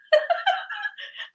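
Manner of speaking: a high burst of real laughter. Real, not acted. Laughing at her son's history class, high real laugh.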